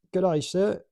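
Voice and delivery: a mild mock Australian greeting; Australian accent. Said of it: "I don't know why I made him Australian".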